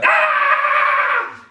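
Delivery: sudden very high shrieked yelp; scared yelp. Retold or imitated. Drunk lad spooked by a growl, high shriek. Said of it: "the standard reaction, ... is to go".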